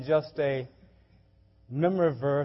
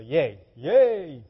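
mock cheer; 7 dB louder than usual. Shown as a demonstration. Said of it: "it's more than just a".

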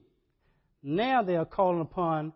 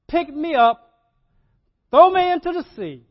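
Jonah's shout; 11 semitones higher, 13 dB louder than usual. Acted. Jonah to the sailors, shouting loud and high. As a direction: belt it out like a desperate prophet.

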